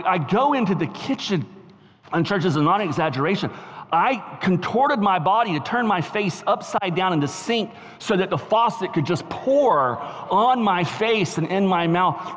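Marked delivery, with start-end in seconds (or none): none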